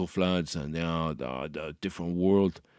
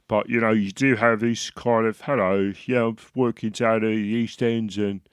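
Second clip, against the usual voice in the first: put-on louder cockney geezer, East End accent; brash London East End accent, pushed out loud; Danny Dyer cockney; 4 semitones higher, 6 dB louder than usual. Comedian mimics an East End cockney, loud and brash. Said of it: "sort of Danny Dyer".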